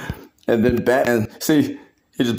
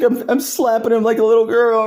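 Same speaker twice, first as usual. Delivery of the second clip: hammed-up high sob; whiny crying; 10 semitones higher than usual. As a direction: whine it like a crybaby. Superman whining.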